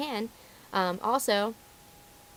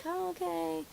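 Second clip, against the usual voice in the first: high, soft, put-on sweetness; fake nice; 9 semitones higher, 8 dB quieter, slower than usual. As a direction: play meekly agreeable to a yelling customer. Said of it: "You're really nice".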